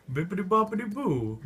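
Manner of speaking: high, loud fake posh British nonsense; hammed-up British accent gibberish; mock British gibberish. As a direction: parody Queen's English. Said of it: "He's like".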